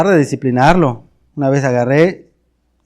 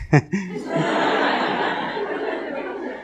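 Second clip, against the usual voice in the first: soft unvoiced laugh trailing up; breathless laugh; 5 semitones higher, 8 dB quieter than usual. Real, not acted. Preacher laughing softly at his own confession. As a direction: let a quiet embarrassed laugh slip out.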